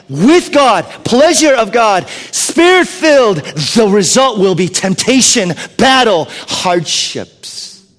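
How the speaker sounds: loud, high, slow sermon build; fiery preaching. Acted. Pastor preaching about the spirit-filled life with full fervor.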